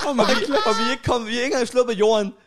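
laughing retelling. Real, not acted. Friend recalls both of them crying at take-off, laughing helplessly. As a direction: tell it cracking up, pitch climbing.

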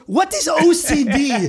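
hammed-up baffled tattooist; scornful tattoo artist. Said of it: "He goes".

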